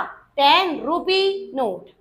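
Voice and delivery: drawn-out, deliberate teaching delivery; slow, evenly stretched words; slow model answer. Shown as a demonstration. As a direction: give each word its own space for the class. Teacher writes out the phrase.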